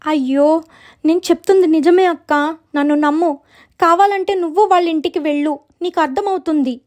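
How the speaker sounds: high, pleading character voice; insistent little sister. Acted. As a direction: press her to believe it. Sister insists she tells truth.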